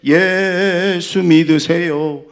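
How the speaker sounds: loud, high, very slow holler; drawn-out street evangelism call. Shown as a demonstration. Preacher recalls how they used to evangelize, drawn-out call. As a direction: stretch 예수 out long and loud. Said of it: "우리 했었잖아요".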